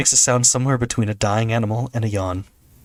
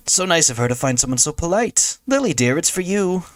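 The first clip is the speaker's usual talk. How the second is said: high, chirpy phone greeting, climbing higher; overenthusiastic mother; 8 semitones higher than usual. Acted. Mother passing the phone to Lily, overenthusiastic. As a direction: gush politely, then call out higher for your daughter. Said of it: "a little overenthusiastic given the mundane situation".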